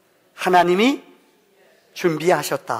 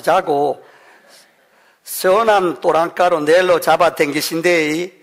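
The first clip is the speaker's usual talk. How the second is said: Gyeongsang dialect. Pretend. Pastor reading Psalm 23 to congregation in Gyeongsang dialect. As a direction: preach it like a gruff Busan uncle. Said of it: "경상도 버전이".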